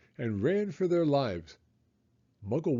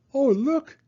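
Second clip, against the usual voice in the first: high, loud delighted cry; children cry out; 6 dB louder than usual. A narrator's reading. Children find a new seesaw, excited cry. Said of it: "they cried".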